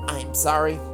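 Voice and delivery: ordinary talk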